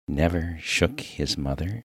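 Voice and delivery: "Never shook his mother?" as a doubtful question; puzzled echo. Acted. Minister baffled by the miner's praise, echoing "Never shook his mother?" questioningly.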